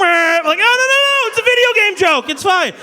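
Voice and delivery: high, frantic mock panic; panicked backpedal. Comic backpedaling to the crowd, high panicked voice. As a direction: yelp out a frantic denial.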